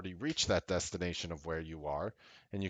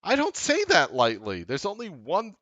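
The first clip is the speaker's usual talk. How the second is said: much higher, much louder hype shouting; real passionate yelling to the viewers; fired-up shout; 12 dB louder than usual. Real, not acted. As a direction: hype the community up at the top of your voice. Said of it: "it sounds crazy to talk like that".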